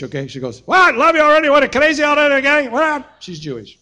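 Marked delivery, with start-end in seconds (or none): 0.6-3.1 put-on much higher, louder Yiddish-accented outrage.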